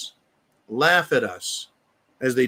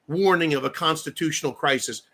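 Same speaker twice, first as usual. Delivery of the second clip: ordinary delivery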